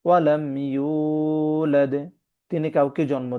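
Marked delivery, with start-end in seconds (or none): 0.1-1.9 very slow, drawn-out tajweed chant; Quran recitation. Acted. Teacher reciting the verse for the class, slow chant. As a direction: recite it slowly like a qari.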